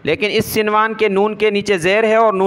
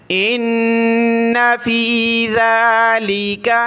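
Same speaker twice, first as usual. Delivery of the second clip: slow, drawn-out chanted recitation; Quranic recitation; 3 semitones higher, much slower than usual. Shown as a demonstration. Teacher models the verse for the student, slow chant. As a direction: recite each word long and even.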